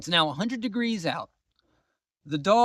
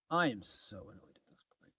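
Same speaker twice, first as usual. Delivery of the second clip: whispered grumble of annoyance; a quiet, seething aside; annoyed whisper; 7 semitones lower, 8 dB quieter than usual. Real, not acted. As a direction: hiss it under your breath, fed up. Repeating her annoyance, now whispered.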